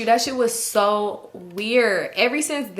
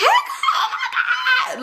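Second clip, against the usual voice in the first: very high, drawn-out squeal; mock scream; much slower than usual. Friend greeting her at the club, imitated long high scream. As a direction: imitate an over-the-top greeting shriek, held long. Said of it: "you wanna scream".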